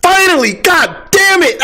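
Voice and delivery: high, slow triumphant yell; excited shout. Retold or imitated. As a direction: cheer it out in triumph. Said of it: "and I was like".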